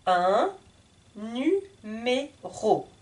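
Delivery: "numéro" drawn out slowly, syllable by syllable. Shown as a demonstration. Teacher slows the word down for beginners.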